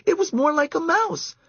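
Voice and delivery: put-on high girlish voice, insistent. A narrator's reading. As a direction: camper sure it was a mouse. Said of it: "said Karen".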